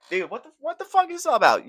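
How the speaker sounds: mock disbelief. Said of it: "And I'm like".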